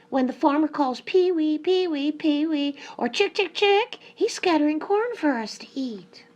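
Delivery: high, loud hen voice; hen character. A narrator's reading. Hen tells the peacock about the corn, high and loud. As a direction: pipe up high as an eager hen. Hen explains the farmer's call.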